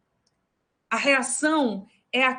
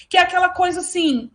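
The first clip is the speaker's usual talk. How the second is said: usual speaking voice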